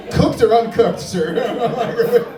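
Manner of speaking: shouted high and loud through laughter; laughing shout. Real, not acted. Comic calling out to a crowd member, loud laughing shout. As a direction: yell it out at the crowd, cracking up.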